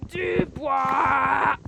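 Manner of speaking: loud drawn-out cry; yelled. Real, not acted. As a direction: shout the words out long. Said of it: "J'arrête de gueuler".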